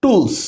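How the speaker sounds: টুলস with a hard retroflex ট; টুলস pronounced like Bengali ট; English t as ট. Shown as a demonstration. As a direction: say টুলস starting on ট. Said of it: "টি এর উচ্চারণ কেমন হয়েছে? ট এর মতো".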